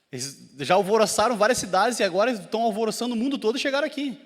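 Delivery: rushed, high, loud retold warning; mock panicked alarm. Townsmen warning that troublemakers have arrived, fast and high. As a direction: imitate a rattled crowd spreading alarm.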